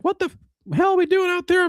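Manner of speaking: mock a raspy chain-smoker coach chewing out his team; chain-smoking coach. Imitating the coach chewing out his team, high strained put-on voice. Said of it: "Coach definitely sounds like he chain smoked a pack of cigs".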